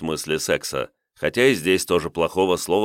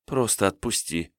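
sighing plea; 3 semitones higher, 4 dB quieter than usual. Acted. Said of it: "Девчонка неожиданно вздохнула".

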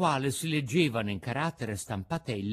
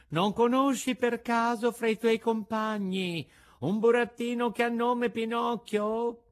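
pigeon voice; 7 semitones higher than usual. A narrator's reading. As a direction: call gently down from the sky. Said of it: "soggiunse allora il colombo".

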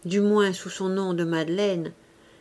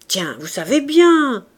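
louder, rising astonished retort; good-natured disbelief; surprised old man; 3 semitones higher, 8 dB louder than usual. A narrator's reading. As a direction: say it as if it were obvious.

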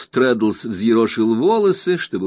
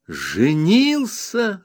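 narrator's own voice, glad echo; joyful exclaim; 9 semitones higher, much slower than usual. A narrator's reading. Copperfield echoing Traddles's news, joyfully. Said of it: "радостно воскликнул я".

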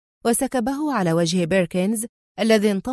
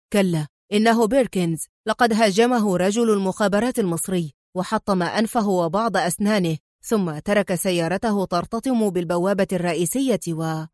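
usual speaking voice

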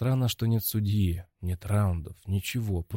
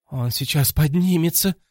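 higher, louder bark; 6 semitones higher, 4 dB louder than usual. A narrator's reading. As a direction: bark out the warning. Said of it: "рявкнул Максвелл".

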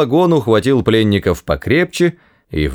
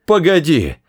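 high urgent cry; 8 semitones higher than usual. Acted. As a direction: shout it to stop him. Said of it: "вскричал он".

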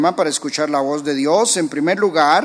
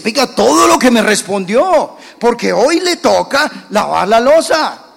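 put-on loud, higher retort to a mouthy kid; scolding father; 5 semitones higher, 5 dB louder than usual. Father scolding his son who talked back, loud. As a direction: snap back like a fed-up dad.

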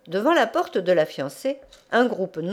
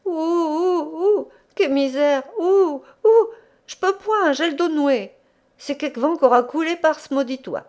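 gémissements; 8 semitones higher, 3 dB louder than usual. A narrator's reading. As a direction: groan theatrically in pain, voice pitched well up. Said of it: "se mit à gémir". (vieillard qui gémit et se lamente)

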